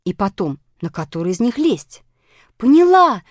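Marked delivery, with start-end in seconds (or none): 2.6-3.2 high, loud cry of realization; sudden shout. A narrator's reading. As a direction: burst out as the idea hits. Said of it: "вдруг закричала Аля".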